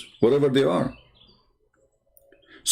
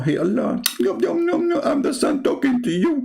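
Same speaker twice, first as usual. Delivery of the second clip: high-pitched silly sun voice; mocking cartoonish squeal as the sun; mock talking sun; 13 semitones higher than usual. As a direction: play the sun piping up to Allah in a very high voice. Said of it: "The son talk to Allah".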